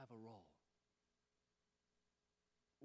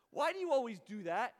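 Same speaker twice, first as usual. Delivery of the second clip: loud, higher-pitched blunt question; blunt challenge; 7 semitones higher, 14 dB louder than usual. Retold or imitated. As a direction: call them out, loudly. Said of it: "always says what's on her mind".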